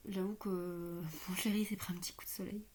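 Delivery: laughing whispered aside; whispering. Real, not acted. Confiding aside about her partner.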